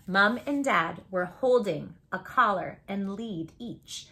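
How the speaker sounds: ordinary delivery, nothing marked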